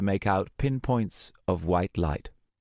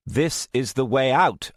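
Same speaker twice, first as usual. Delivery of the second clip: irritated insistence; 7 semitones higher, 5 dB louder than usual. Acted. Arguing angrily.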